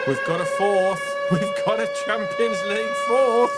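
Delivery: high, loud celebratory shout; jubilant shouting. Real, not acted. Host celebrates caller's fourth-place prediction, jubilant shouting. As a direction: shout it out with delight, stretching key words.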